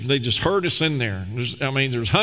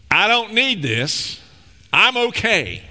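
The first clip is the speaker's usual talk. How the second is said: higher, slow, insistent refusal; acted protest; 5 semitones higher, 4 dB louder, slower than usual. Person refusing what God offers, acted protest. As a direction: push help away with hammed-up insistence. Acting out people refusing God's help.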